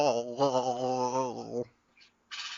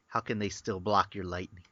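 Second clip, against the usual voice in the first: ordinary delivery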